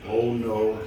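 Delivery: ordinary delivery, nothing marked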